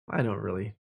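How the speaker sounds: usual speaking voice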